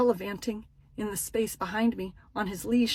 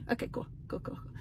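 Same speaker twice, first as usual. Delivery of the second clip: very soft, trailing near-whisper; small, hushed, resigned acknowledgement; deflated acceptance; 12 dB quieter than usual. Retold or imitated. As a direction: mumble it away, fading to almost nothing. Deflated reply after being brushed off.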